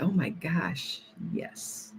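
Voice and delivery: whispered, slow; hushed mock viewer reaction; breathy hush. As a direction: whisper her amazed reply. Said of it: "she's like".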